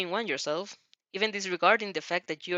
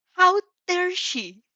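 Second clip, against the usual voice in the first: slow, indignant, higher outraged line; grumbling; 12 semitones higher, 6 dB louder, much slower than usual. A narrator's reading. Mother offended at the pushy journalist, slow indignant grumble. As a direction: drag it out in offended disbelief. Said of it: "the woman grumbles".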